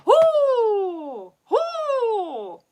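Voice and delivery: high, loud hoots with a laugh; owl hoots. Retold or imitated. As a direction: give two sharp owl hoots. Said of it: "They'll just kinda".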